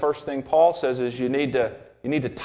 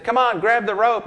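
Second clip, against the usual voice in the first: helicopter rescuer; 8 semitones higher, 3 dB louder than usual. Retold or imitated. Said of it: "helicopter hovered overhead and said".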